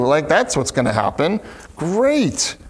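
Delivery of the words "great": sarcastic. Retold or imitated. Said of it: "could be almost sarcastic".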